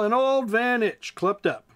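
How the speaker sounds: plain reading voice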